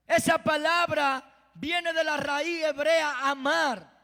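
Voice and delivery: ordinary talk, nothing marked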